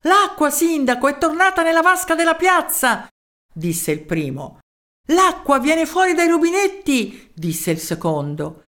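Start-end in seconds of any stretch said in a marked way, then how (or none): 0.1-3.1 high-pitched, urgent announcement; excited news. A narrator's reading. First townsman telling the mayor of the water, excited. 5.1-7.3 high-pitched thrilled report; excited news. A narrator's reading. Second townsman telling the mayor of the taps, excited.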